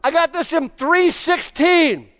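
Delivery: high, loud, slow shout after a hard row; rower yelling out. Retold or imitated. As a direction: call out your time, winded and proud. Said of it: "he yelled out".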